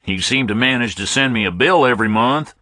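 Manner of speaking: put-on ribbing the phone company in a Southern accent; a Southern caller teases the phone company about his bill; jocular southern man. A narrator's reading. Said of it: "Man-to-man jocular stuff".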